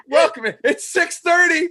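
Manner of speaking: mock announcer. Mocking a late podcast start.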